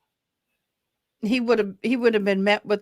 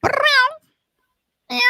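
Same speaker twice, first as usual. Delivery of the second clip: high meowing; cat meow; 4 dB louder, much slower than usual. Pretend. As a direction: meow like a kitten. Calling the cat.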